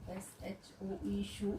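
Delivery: soft, slow letters then शू; spelling it out. Shown as a demonstration. Teacher slowly spelling out शू for students. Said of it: "And the last sound is".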